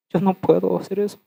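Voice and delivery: normal talking voice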